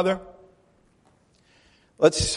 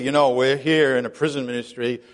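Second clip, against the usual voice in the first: lower, put-on holier-than-thou tone; mock pious voice; 7 semitones lower, 3 dB quieter than usual. Preacher mocking pious prison ministers, lower mock-holy voice. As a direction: parody a prim, proper church lady. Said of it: "we didn't just say".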